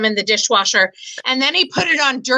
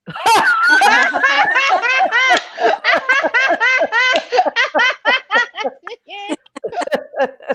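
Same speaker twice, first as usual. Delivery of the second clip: big laugh; 11 semitones higher than usual. Real, not acted. Laughs at own joke.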